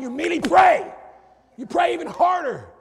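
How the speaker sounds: loud, high-pitched preaching punchline; urgent shout. Real, not acted. Preacher joking to church about Biden news, urgent shout. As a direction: burst out with comic urgency.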